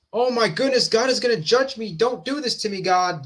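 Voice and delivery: mock panicked plea. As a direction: plead with God in mock dread. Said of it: "Not the".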